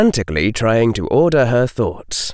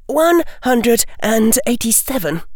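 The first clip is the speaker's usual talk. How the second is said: put-on high girlish voice for Hermione; much higher girl voice, demanding; young girl's voice; 13 semitones higher than usual. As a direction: play the bright, bold schoolgirl.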